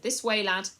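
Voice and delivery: loud brisk command; firm call. A narrator's reading. Lord leading his new slave away, brisk. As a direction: call the lad along.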